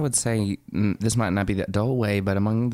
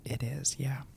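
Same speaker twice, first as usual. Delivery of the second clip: sheepish whisper; 4 semitones higher, 10 dB quieter than usual. Acted. Admitting the schnapps.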